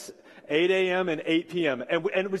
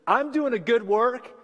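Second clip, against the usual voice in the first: higher, louder exasperated retort; 8 semitones higher, 5 dB louder than usual. Retold or imitated. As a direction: fire back, fed up with the hecklers. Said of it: "Nehemiah, just exasperated".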